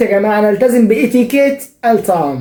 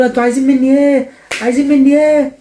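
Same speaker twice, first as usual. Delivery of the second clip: imitating angry driver; 6 semitones higher than usual. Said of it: "وكل شوية يقول".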